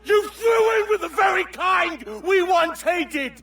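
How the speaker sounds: high, loud, slow raging shout; furious shout. Acted. Captain screams at the traitor who joined the Templars. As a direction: roar the betrayal at him. Angry quarrel between old friends.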